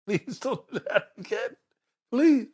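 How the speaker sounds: nervous laughing plea, slow then a high Please. Retold or imitated. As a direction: beg him through a laugh. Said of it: "I said, "My man".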